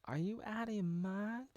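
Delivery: high-pitched mock indignation; upset girl. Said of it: "The girl's upset".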